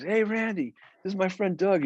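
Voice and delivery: high, eager introduction; retold eager greeting. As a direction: imitate a friend calling hello. Retold backstage greeting to a friend.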